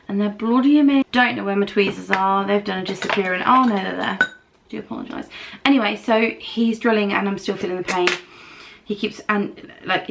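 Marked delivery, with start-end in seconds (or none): 4.7-5.3 whispered aside. Real, not acted. Aside after finding tweezers.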